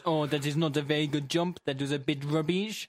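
mock French presenter passing judgement; comic French accent ending in a chuckle. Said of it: "and Mister Potato goes".

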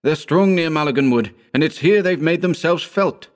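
high, alarmed warning; frantic urging. A narrator's reading. Howard warning of the invaders, frantic and high. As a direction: pour out the warning, pitched well up. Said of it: "he urged frantically".

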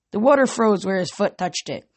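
usual speaking voice

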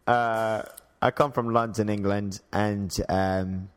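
an attempted English accent; British accent. Shown as a demonstration. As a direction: hesitantly put on a British accent.

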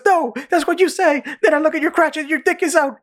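very high, squeaky refusal; mock accusing. Retelling the accuser refusing to look, mock accusing. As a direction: argue it in a comic squeal. Acted back-and-forth quarrel from show.

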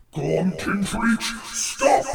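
slow, rumbling great dragon. Acted. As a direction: boom it out slowly like a huge dragon. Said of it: "the gold dragon's voice just rumble".

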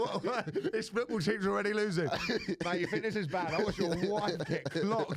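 high, breezy matey tone about the match; casual halftime chat. Pretend. Mock telling a mate at half time, breezy and high. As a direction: toss the bad news off lightly, like footy small talk. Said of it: "I'd say at half time during the game".

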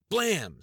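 loud, sharp shouted bang; shouted shotgun blast. A narrator's reading. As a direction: bark the shot out.

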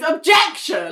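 imitating an angry lawyer's outburst; outraged objection. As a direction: cry out in mock courtroom outrage. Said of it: "fucked right off".

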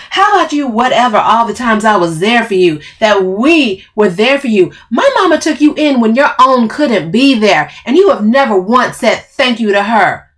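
loud, high, accusing tirade; furious reproach. Acted. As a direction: list the old favours angrily.

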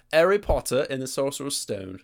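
mock British accent, r's dropped. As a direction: say the title like a pretend Brit. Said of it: "what is it called in Great Britain".

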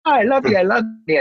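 high, gushing mock affection; two-faced sweetness. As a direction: imitate someone putting on love to your face. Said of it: "and then she turns around".

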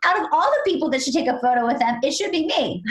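quick, high-pitched playful protest; hammed-up indignation, rising in pitch; mock upset. Host was refused a photo with them, mock upset. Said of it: "I was really upset".

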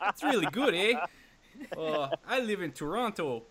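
high hammed-up Canadian accent with an eh; exaggerated, badly faked Canadian voice. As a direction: put on a caricature Canadian accent. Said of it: "can you do it in a Canadian accent".

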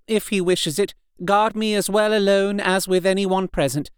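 a hint of a brave wife; lightly voiced woman. A narrator's reading. Mina speaks bravely to husband.

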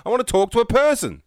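high-pitched exasperated plea to a machine; mock frustrated caller demanding a human. Yelling at phone robot.

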